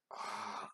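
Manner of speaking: a hushed, breathless "ah" of pain held back; stifled pain. Retold or imitated. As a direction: mute a wince so no one hears. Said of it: "I didn't wanna show pain".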